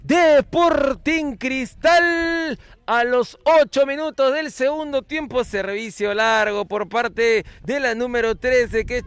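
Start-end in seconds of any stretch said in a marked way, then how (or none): 0.0-5.4 excited. Real, not acted. After goal call.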